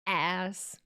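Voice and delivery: ordinary talk, nothing marked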